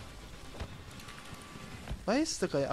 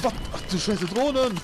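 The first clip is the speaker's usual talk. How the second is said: startled exclamation; 5 semitones higher, 3 dB louder than usual. Real, not acted. Spots drones suddenly.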